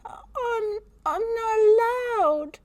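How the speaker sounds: put-on high, slow, crying little girl; tearful child. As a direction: plead on the edge of tears. Frightened child near tears.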